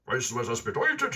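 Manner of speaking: a mocking robber gloating; robber Hotzenplotz. A narrator's reading. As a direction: robber taunting the captured boy. Hotzenplotz voiced by reader.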